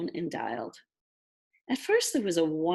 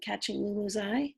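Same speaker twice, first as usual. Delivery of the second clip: plain reading voice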